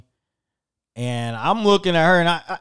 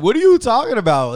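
louder, higher, incredulous; exasperated outburst; 9 semitones higher, 8 dB louder than usual. Retold or imitated. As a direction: blurt it out in disbelief at the cashier. Exasperated retort to cashier, laughing.

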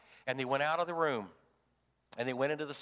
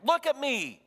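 much higher and louder, insisting; urgent command; 9 semitones higher, 4 dB louder than usual. Acted. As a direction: make him lift his eyes. Jesus urges ashamed Peter.

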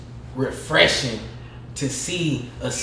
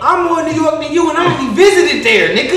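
loud, much higher, laughing disbelief; higher, louder mocking rant at a poser; incredulous shout; 10 semitones higher, 8 dB louder than usual. Real, not acted. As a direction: call him out loudly, half laughing. Mocking a fake New Yorker.

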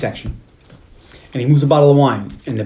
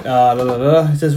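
ordinary talk, nothing marked

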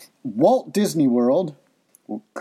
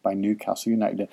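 ordinary talk

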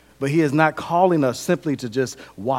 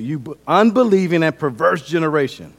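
loud, scolding quote of Jesus; hard rebuke; 3 semitones higher, 7 dB louder than usual. Acted. Jesus scolds the faithless generation, hard rebuke. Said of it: "Jesus kinda hard".

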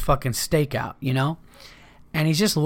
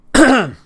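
loud sharp throat clear; 11 semitones higher, 11 dB louder than usual. Real, not acted. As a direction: clear the throat hard before going on. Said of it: "Excuse me".

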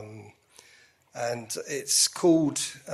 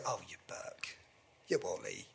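jokey; 7 semitones lower, 14 dB quieter than usual. Retold or imitated. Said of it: "in that jokey way".

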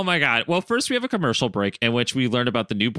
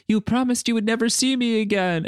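mock Mariko; 11 semitones higher than usual. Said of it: "Mariko's like".

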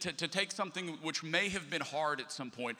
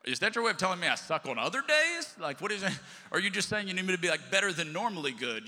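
louder, higher retort, playfully offended; mock indignation; 5 semitones higher, 6 dB louder than usual. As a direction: retell it as an affronted comeback. Acts out his indignant reply.

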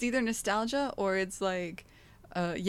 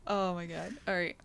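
ordinary talk, nothing marked

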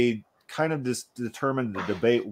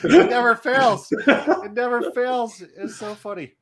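high-pitched, laughing through the words; amused, laughing; 10 semitones higher, 4 dB louder than usual. Real, not acted. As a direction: crack up at a joke that always lands. Cracking up at a running joke.

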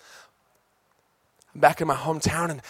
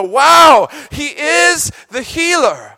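sudden high awed cry of wonder; high shouted wow, then slow awe; awed revelation shout; 11 semitones higher, 13 dB louder, much slower than usual. Acted. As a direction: burst out in amazed revelation. Revelation awakening, acted exclamation.

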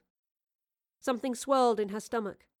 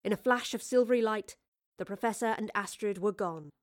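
ordinary delivery

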